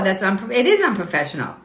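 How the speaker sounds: ordinary talk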